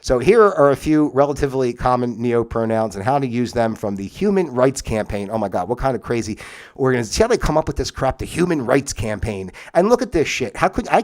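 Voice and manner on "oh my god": usual speaking voice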